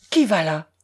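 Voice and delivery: a sentry's low call across water; commanding, ringing hail; imperious challenge. A narrator's reading. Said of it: "Impérieusement, cette question résonna sur la mer".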